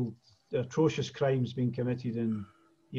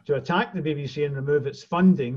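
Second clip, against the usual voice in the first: normal talking voice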